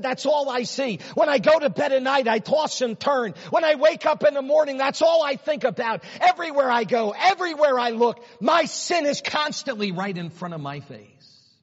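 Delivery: high, loud anguished outpouring; a guilty king confessing his sleepless misery; anguished confession. Acted. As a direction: cry out his torment. David voicing his guilt.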